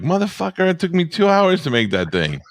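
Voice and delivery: high-pitched outraged voicing of the video maker; indignant mouse-trap maker. Voicing the trap builder's outrage at the mouse, high and indignant. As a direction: squeal it like the craftsman can't believe it. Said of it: "This guy's staring at his video like".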